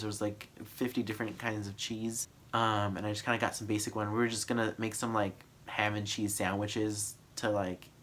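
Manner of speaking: usual speaking voice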